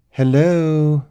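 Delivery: high, loud, drawn-out friendly call; warm and friendly. A narrator's reading. Elizabeth calling to strangers outside the chamber, warm and friendly. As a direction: call out slowly and warmly. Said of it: "ensuring she sounded warm and friendly".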